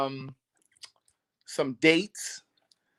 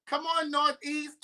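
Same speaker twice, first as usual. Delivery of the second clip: drawn-out shout-out; 10 semitones higher, 7 dB quieter, slower than usual. Real, not acted. Hyping up guest's neighborhood.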